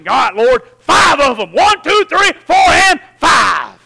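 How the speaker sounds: excited counting prayer. Retold or imitated. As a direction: shout the count to God in hope. Counting souls aloud in prayer.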